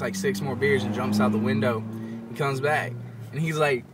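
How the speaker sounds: usual speaking voice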